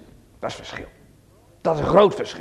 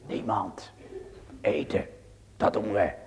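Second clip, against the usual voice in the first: whisper; 10 semitones lower, 10 dB quieter than usual. Acted. Dramatic whispered answer.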